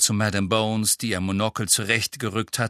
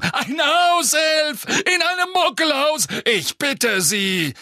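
higher, louder scoffing shout; blustery pompous mayor; 4 dB louder, slower than usual. A narrator's reading. Fudge scoffing.

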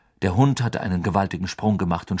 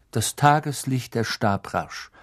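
plain reading voice

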